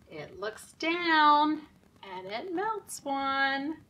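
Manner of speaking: rhythmic nursery rhyme chant, slow on the sun; sing-song rhyme. Acted. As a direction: chant the rhyme for little kids. Reading rhyme at story time.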